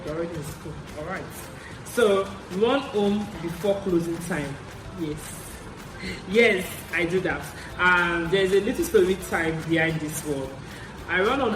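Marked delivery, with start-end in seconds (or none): none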